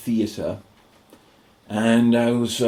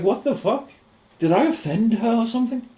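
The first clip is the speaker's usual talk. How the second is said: baffled; 12 semitones higher than usual. Retold or imitated. Retelling his confusion at party.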